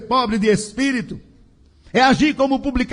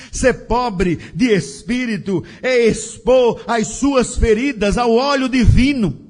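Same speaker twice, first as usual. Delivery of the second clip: ordinary talk, nothing marked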